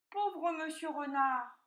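slow, high mock-pitying lament; piglet sighing. A narrator's reading. Piglet over the exhausted fox, slow sighing pity. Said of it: "soupira le porcelet".

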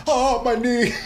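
high, strained cry of a hurt knee; mock injured old man. Teasing a friend about his knee giving out, high mock yelp. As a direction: yelp it up high like your knee just popped.

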